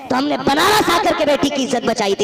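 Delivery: loud, proud preacher's boast; fiery preaching. Acted. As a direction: preach it out to the crowd. Preaching at full force.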